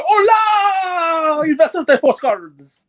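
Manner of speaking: high shouted announcer "Hola", slow and stretched; Jeff in Spanish. Pretend. Mocking the guest, a loud pretend Spanish intro. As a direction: belt it like a Spanish ring announcer. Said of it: "translate Jeff into multiple languages".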